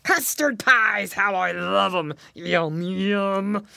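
slow, gloating hungry dog; a hint of a greedy villain dog. A narrator's reading. Greedy dog eyes the pies.

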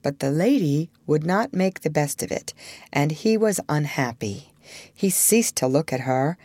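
ordinary delivery

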